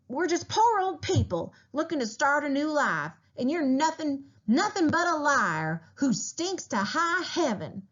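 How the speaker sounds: put-on high, shrill-pitched outraged old woman; indignant scolding. A narrator's reading. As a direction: tear into him with outrage. Old wife scolds the leader.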